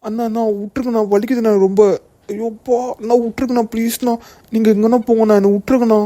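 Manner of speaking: high, hurting plea for mercy; pained pleading. Acted. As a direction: beg them to let you go, high-pitched. Beaten man begs to be released.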